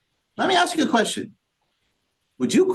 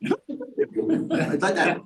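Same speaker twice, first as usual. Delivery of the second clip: high, soft, very slow laughing imitation of an old woman; a light imitation of an old woman's murmur; grandmother's mumble; 10 semitones higher, 5 dB quieter, much slower than usual. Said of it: "she goes".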